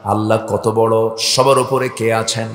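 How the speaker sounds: ordinary talk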